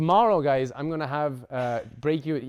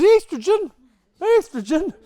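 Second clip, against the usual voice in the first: much higher, louder mock yell; mock shout; 11 dB louder than usual. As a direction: shout it like an eager know-it-all class. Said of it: "Everyone always says".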